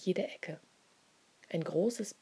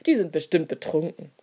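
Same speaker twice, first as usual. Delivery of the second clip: louder, scoffing jeer; mocking; 9 dB louder than usual. A narrator's reading. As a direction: sneer at them as drunks. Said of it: "lachen und machen sich lustig". (spöttisch, höhnisch)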